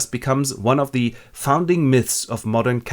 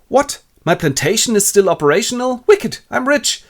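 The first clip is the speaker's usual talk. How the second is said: high and loud, delighted; gleeful surprise; 11 semitones higher, 5 dB louder than usual. Acted. Robinson learning his plantation still runs, high and gleeful. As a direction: rich again, thrilled.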